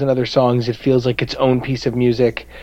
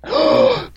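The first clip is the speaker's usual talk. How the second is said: high, sudden theatrical gasp; sharp gasp. Acted. Shocked realization about the song.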